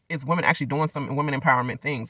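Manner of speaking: usual speaking voice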